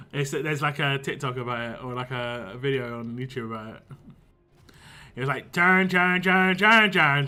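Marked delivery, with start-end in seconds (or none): 5.5-7.3 loud, repetitive Pittsburgh-accent impression; exaggerated Pittsburgh twang on identical-sounding words; mock Pittsburgh accent. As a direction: say it loudly in a mock Pittsburgh accent, every word alike. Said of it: "it also always sounds the same".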